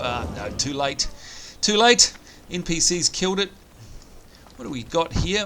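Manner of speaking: usual speaking voice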